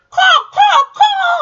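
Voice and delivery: high, loud crow calls; crow caw. Shown as a demonstration. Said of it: "Do you know the sound a crow makes?".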